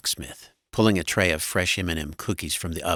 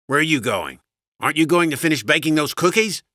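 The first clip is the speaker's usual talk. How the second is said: loud, pitched-up husband; raised, nagging husband voice; demanding husband; 10 semitones higher, 6 dB louder, faster than usual. A narrator's reading. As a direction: question her like a bossy husband.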